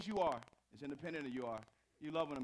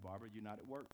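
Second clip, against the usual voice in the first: firm husband; 7 semitones lower, 7 dB quieter than usual. Retold or imitated. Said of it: "I'm like".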